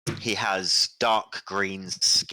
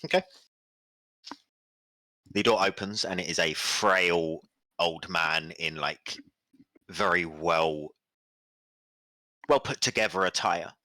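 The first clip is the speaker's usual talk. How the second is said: ordinary talk, nothing marked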